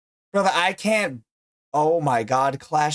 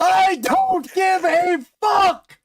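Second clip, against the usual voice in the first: high, slow drawn-out yell; slow shout; 12 semitones higher, 3 dB louder, slower than usual. Real, not acted. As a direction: stretch it out and shout it.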